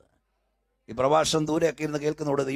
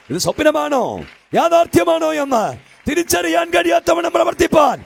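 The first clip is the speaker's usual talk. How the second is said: rising from firm to a much higher, louder shout; building to a shout; 9 dB louder than usual. Acted. Preacher crying out God's power to the hall. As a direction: swell into a triumphant cry.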